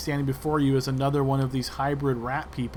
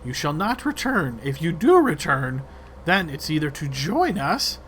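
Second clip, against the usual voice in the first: menacing creature leader; rat leader threatening; 3 semitones higher than usual. Acted. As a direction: lay down the terms, climbing into a shrill shout. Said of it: "the rat leader's terms".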